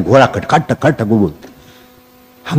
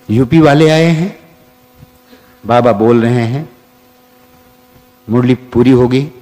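ordinary talk, nothing marked

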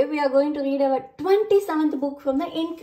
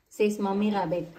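ordinary delivery

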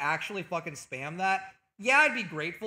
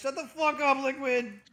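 exasperated outburst; 5 semitones higher, 3 dB louder than usual. Real, not acted. Streamer snaps at teasing viewer.

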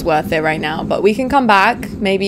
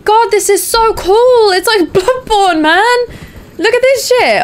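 mock-awestruck high voice; silly put-on voice; 13 semitones higher, 5 dB louder than usual. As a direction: gush in a high theatrical voice. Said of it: "I said it in that voice".

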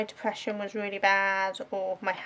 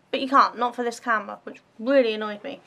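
ordinary delivery

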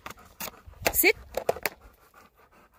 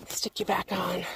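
whisper; 6 dB quieter than usual. Real, not acted. Quiet aside while handling dog.

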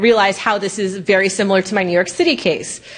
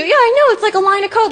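amused disbelief; 11 semitones higher, 3 dB louder than usual. Real, not acted.

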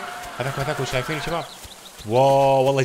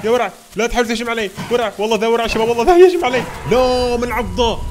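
high, loud frantic shouting that peaks; panicked yelling; 7 semitones higher, 7 dB louder than usual. Real, not acted. Dinosaur attacks, he yells.

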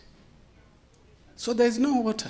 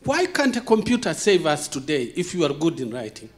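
ordinary delivery

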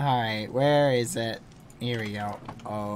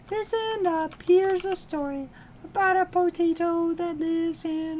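high squeaky announcer voice, much higher; silly storyteller voice; 12 semitones higher than usual. Acted.

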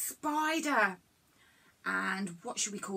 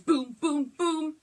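louder, rising boom boom boom; hammed-up booms the way Debs does; imitating Debs' booms; 4 semitones higher, 5 dB louder, slower than usual. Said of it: "When we do that at the library, she's".

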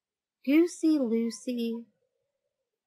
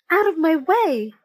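high, loud yell to clear the path; a frantic chick's shout; 6 semitones higher, 6 dB louder than usual. Acted. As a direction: bellow it as you rush past. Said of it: "yelled Chicken Licken".

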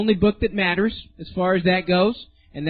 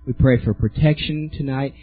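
lower, earnest prayer voice; 4 semitones lower than usual. Real, not acted. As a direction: pray it over the room. Closing prayer over congregation.

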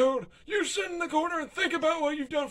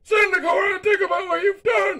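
loud, higher reprimand; scolding shout; 6 semitones higher, 7 dB louder than usual. Acted. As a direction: yell it like a cross parent. Dictator scolds Toad.

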